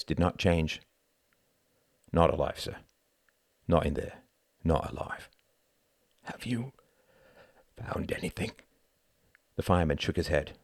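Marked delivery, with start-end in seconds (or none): 2.1-5.3 lower, grave fireman's voice; grim fireman. Acted. Fireman telling the father the news, low and grave. 6.2-8.5 softer, half-whispered question; hushed. Acted. Family member asking the fireman, hushed and stunned.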